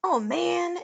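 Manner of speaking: loud, high wail of dismay; dismayed cry. A narrator's reading. Neil sees stairs fading, loud dismayed cry. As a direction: cry out as trouble hits. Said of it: "Neil cried".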